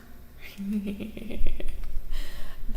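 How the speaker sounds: breathy run of giggles. Real, not acted. Streamer amused mid-reading, breathy giggle. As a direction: giggle lightly through the breath. Laughs during the card stream.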